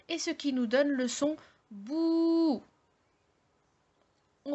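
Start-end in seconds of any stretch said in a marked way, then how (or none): 1.7-2.6 bou with a long, steady, higher ou; high, sustained bou; long vowel. Shown as a demonstration. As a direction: hold the ou of bou well out. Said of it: "deux fois plus long qu'une voyelle brève".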